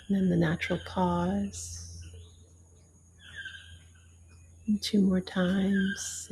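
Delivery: gentle yoga teacher voice counting breaths; guided meditation. Acted. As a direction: ease them through each breath, unhurried.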